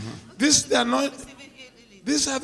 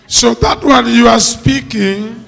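preaching at full force; 11 dB louder than usual. Real, not acted. Preacher retells her decision loudly.

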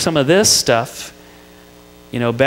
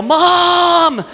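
mock yell for mom; 12 semitones higher, much slower than usual. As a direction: call to mom like a trapped little boy. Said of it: "but I'd yell".

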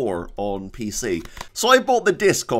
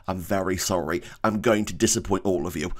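ordinary talk

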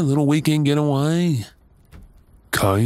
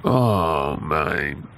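usual speaking voice

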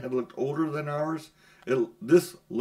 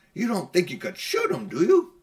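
cabby; 5 semitones higher, 5 dB louder than usual. Acted. Cabby voiced in story.

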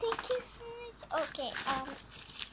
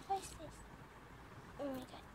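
normal talking voice

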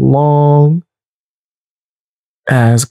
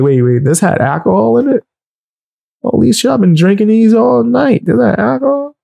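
retold shock; 8 semitones higher than usual. Realizing drinks had alcohol.

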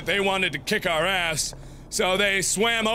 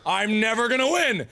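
normal talking voice

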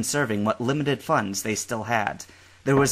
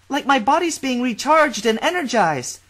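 put-on high, louder cheerful girl; young woman voice; 14 semitones higher, 6 dB louder than usual. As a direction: play a bubbly girl in the shower.

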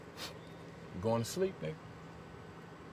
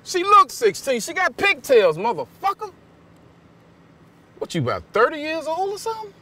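higher, louder enraged shouting; an outraged dad tearing into him; loud, high furious shout; furious yell; 9 semitones higher, 11 dB louder than usual. Acted. Angry father threatens boyfriend.

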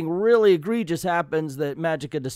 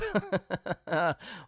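higher-pitched staged chuckle; mocking laugh; 5 semitones higher, 4 dB quieter than usual. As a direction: laugh off a silly idea in character. Scrooge laughs at Magica.

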